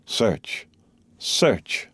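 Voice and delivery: a hushed, icy command to search; cold command. A narrator's reading. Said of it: "said the Count, crossing his arms".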